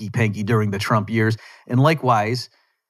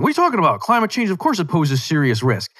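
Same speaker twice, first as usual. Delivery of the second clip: high-pitched, incredulous mock objection; mock flipping out; 6 semitones higher than usual. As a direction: mimic an outraged critic, starting very high. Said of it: "some people were flipping out".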